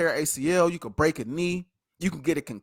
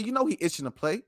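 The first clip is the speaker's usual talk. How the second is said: ordinary talk, nothing marked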